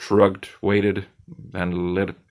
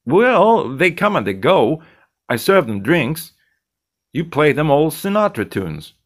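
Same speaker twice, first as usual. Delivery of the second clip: higher, pushy bartender lecturing his friend; cocky bartender Zed; 5 semitones higher, 4 dB louder than usual. Acted. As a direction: needle him like the slick bartender.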